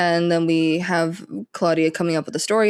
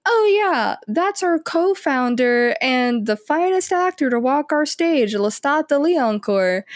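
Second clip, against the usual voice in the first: high-pitched impression, settling toward the end; drawn-out, put-on show of casual pride; Armand; 8 semitones higher, slower than usual. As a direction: imitate someone airily introducing a co-founder. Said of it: "Armand being like".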